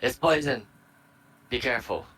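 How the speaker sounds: acted shout of alarm to companions; yelled warning. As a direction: call it out to the whole party, half-yelling. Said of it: "turn to everyone else and just yell up".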